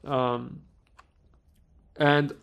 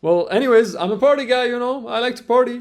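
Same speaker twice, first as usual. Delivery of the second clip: much higher, playful party-guy boast; joking with officers; 8 semitones higher, 5 dB louder than usual. Retold or imitated. Joking to two border officers, higher playful brag. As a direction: lighten the mood with a cheeky boast. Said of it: "I'm like".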